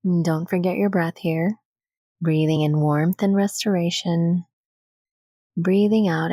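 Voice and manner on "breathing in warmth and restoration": normal talking voice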